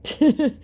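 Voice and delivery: short high giggle; real laugh. Real, not acted. Woman hears the scammer's voice note replayed, laughing. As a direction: giggle briefly, genuinely amused.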